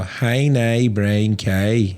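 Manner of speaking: Northern Irish vowels, "cow" like "kay"; broad, mocking Northern Irish accent. Shown as a demonstration. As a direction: mimic North of Ireland "hay nay brain kay". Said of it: "And they'll go".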